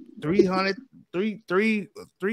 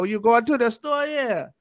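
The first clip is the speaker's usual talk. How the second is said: put-on teasing New Orleans in-law accent; exaggerated New Orleans accent; mock New Orleans accent; 6 semitones higher, 4 dB louder, slower than usual. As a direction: make fun of a New Orleans drawl, pitched up. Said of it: "I make fun of the way how they talk".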